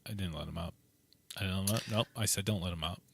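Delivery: low, quiet nervous denial; defensive mumble. Retold or imitated. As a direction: backpedal under your breath. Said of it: "And he's like".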